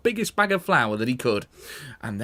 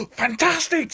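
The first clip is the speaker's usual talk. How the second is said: put-on excited kids; 12 semitones higher than usual.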